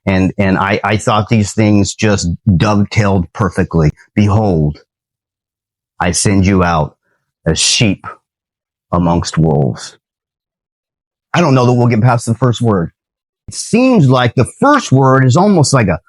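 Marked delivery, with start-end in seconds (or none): 4.1-10.0 low, measured, long pauses between phrases; scripture reading. Acted. Preacher quotes Matthew to viewers, low and deliberate scripture reading. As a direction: recite solemnly, phrase by phrase.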